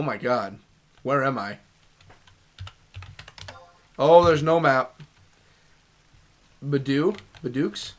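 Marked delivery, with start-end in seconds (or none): none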